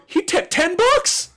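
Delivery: high, slow, astonished exclamation; excited call-out. Retold or imitated. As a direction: call it out amazed across the diner. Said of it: "I just hear".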